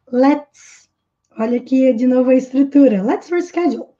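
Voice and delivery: ordinary talk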